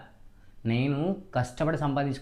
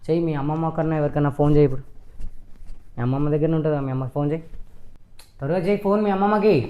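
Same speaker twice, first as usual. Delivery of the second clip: louder, ordering her to call; loud demand; 6 dB louder than usual. Acted. Husband ordering wife to call her mother, loud. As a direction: bark the order to phone.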